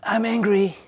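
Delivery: high, tense cry of anger, in a voice not her own; a demo of anger taking over, pitched high; mock anger. Shown as a demonstration. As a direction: blurt it out as if anger owns you. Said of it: "Do you see the difference?".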